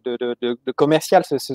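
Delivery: ordinary delivery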